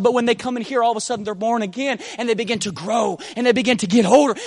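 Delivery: ordinary talk, nothing marked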